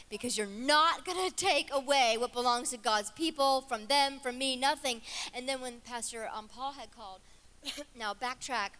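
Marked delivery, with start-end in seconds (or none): 0.1-5.0 loud, emphatic preacher's defiance; defiant declaration. Retold or imitated. Preacher telling off the devil for God's people, defiant. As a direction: lay down the law, forcefully.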